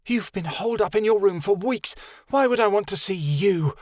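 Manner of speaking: put-on girl in cell scorning Darren, bitter. A narrator's reading. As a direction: throw his absence back at him. Chameleon voice.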